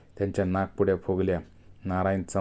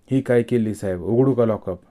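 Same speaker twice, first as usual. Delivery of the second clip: higher and much louder, calling out; 3 semitones higher, 7 dB louder than usual. A narrator's reading. Constable shows the officer a toilet key: loud raised call. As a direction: hold the key up and call it out loudly.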